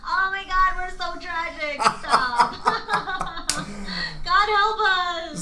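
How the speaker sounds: high, laughing through the words. Real, not acted. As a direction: laugh it off, dragging out the end. Both laughing at themselves.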